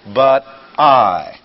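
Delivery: I loud and held out; weighty, emphatic I; stress on I. Shown as a demonstration. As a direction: punch the I hard after but. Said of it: "you must stress I".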